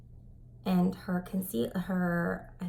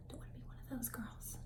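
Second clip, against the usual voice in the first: whispered aside; 2 semitones higher, 15 dB quieter than usual. Real, not acted. Warning about mismatched neck.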